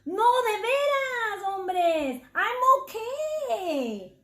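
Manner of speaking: high, slow pleading reassurance; calm them down, please stay; reassuring. Acted. Cat reassuring fleeing mice, high and pleading. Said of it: "Chato reassured".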